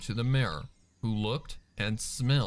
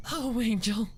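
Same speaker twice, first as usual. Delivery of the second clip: high, tearful cry of Angel's name; 11 semitones higher than usual. A narrator's reading. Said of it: "she cried".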